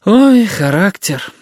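high, slow exasperated sigh. A narrator's reading. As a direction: draw it out as you give up. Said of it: "вздохнула тётя Галя". (со вздохом, протяжно)

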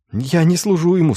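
high, pleading voice; frightened denial. A narrator's reading. Schulz tells inquisitors he serves no sorcerer, high frightened denial. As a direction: plead innocence in a high pitch.

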